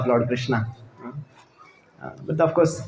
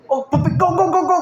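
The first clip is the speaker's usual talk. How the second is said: much higher shooing call, changed voice; shooing the puppy; 3 dB louder than usual. Retold or imitated. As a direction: shoo the puppies off the plate. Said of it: "Bihari came and said".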